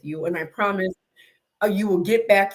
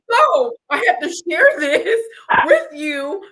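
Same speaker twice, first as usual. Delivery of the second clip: laughing; 6 semitones higher, 5 dB louder than usual. Real, not acted. Laughing after story.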